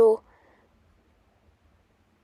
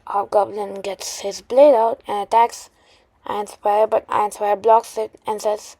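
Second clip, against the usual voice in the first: usual speaking voice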